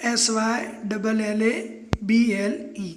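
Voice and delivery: letter by letter, slow and even; spelled out slowly. Shown as a demonstration. Teacher spelling syllable slowly for students, letter by letter. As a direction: spell each letter for the class. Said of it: "spelling है".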